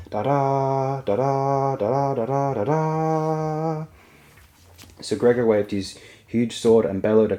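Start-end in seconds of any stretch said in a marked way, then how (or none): 0.1-3.9 higher sung trumpet fanfare over music; blaring trumpets. A narrator's reading. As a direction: blare it out like trumpets. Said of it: "The trumpets blared again".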